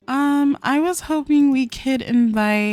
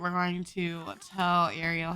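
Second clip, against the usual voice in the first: usual speaking voice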